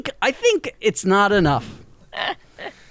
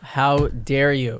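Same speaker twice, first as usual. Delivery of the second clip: mock outrage; 8 semitones lower, much slower than usual. Mock outrage at a joke accusation.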